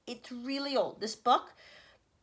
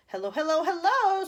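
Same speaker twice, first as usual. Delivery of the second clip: louder and higher each hello; impatient hellos; 6 semitones higher, 8 dB louder than usual. Acted. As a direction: bellow into the phone. President shouting into phone.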